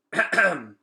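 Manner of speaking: loud clearing of the throat before a speech. Acted. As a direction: clear the throat to call attention. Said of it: "Vicus cleared his throat".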